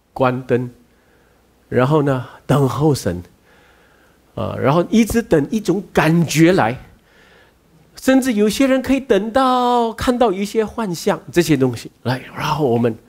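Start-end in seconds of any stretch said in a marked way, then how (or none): none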